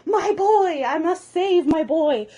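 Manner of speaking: loud, high melodramatic rescuer; hammed-up heroic cry, words stretched out; heroic dragon. Toothless hears his boy in danger, heroic dragon cry.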